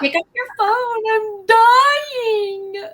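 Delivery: high, loud wail through laughter; mock desperate plea. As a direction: ham up a dying plea down the phone. Dramatic call to her friend.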